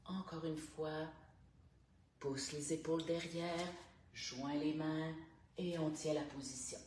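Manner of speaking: calm, unhurried instructor guiding each breath; guided stretching voice. Acted. Morning stretch coach guiding listeners through neck and shoulders, calm. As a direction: talk the class softly through every stretch.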